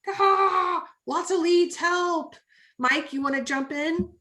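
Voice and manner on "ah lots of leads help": high, loud overwhelmed cry for help; panicked plea. Retold or imitated. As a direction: squeal it like you're drowning in leads. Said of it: "Desiree was just like".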